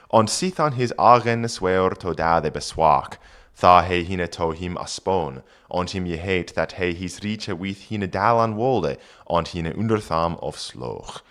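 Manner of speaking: put-on reading Old English aloud; careful Old English pronunciation. Shown as a demonstration. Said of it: "reads Old English text".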